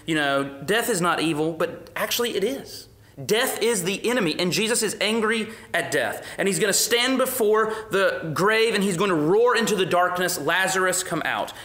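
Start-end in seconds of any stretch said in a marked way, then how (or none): 0.6-10.4 higher and louder, building to a roar; fiery preaching. Real, not acted. 10.4-11.5 calling out. Acted. Jesus calling out at the grave.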